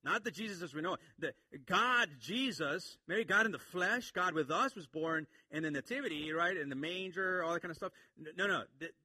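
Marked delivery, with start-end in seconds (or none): none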